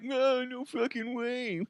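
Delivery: high, soft mocking echo; mocking imitation. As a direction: mimic them mockingly, drawn out and soft. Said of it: "and you're like".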